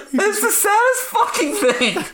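very high, tearful outburst; half crying, half laughing at yourself. Real, not acted. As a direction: burst out through real tears. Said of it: "still makes me fucking cry".

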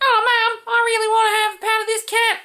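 high whiny commentator voice; sing-song silly whine; whiny voiceover. Retold or imitated. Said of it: "Why did everything have to sound like that? He had one voice".

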